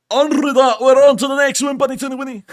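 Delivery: very high, loud comic yell; goofy high-pitched holler; mock shouting. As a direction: belt out the transition in a silly high voice. Sudden shouted comic sign-off between comments.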